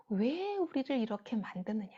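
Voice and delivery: ordinary delivery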